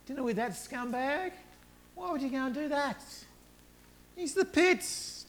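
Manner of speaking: high shocked what, then indignant scoff; outraged disbelief. Retold or imitated. Crowd objecting to Jesus dining with Zacchaeus, high outraged disbelief. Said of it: "Everyone else goes".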